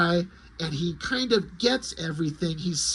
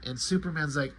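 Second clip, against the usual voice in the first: ordinary delivery, nothing marked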